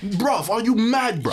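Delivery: very high, slow London challenge; mock London accent. The actor confronting the director, in a mock London accent.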